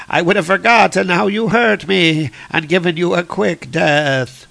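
hammed-up villain monologue, rising; God Butcher. Villain gloating to Thor about their last fight, theatrical menace.

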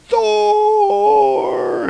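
long, very high yell; hammed-up cry to the heavens; mock battle shout. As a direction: holler the name and hold it. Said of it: "he gives out some shouts".

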